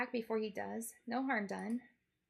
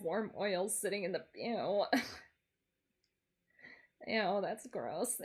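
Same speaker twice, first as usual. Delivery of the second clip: disgusted; 2 semitones lower than usual. Real, not acted. Disgusted at the image.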